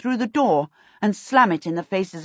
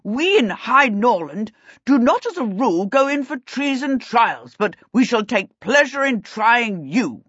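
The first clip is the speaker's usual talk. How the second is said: raised, stately royal pronouncement; indignant king; 4 semitones higher than usual. A narrator's reading. King threatening the prince with trial, stately and indignant.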